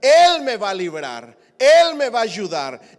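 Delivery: loud, rising preacher's cry; fiery preaching. Acted. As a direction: preach it out to the whole church. Preaching at full force.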